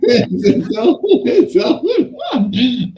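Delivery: high-pitched, laughing echo; amused mimicry. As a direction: repeat it high through a laugh. Laughing repeat of the retold line.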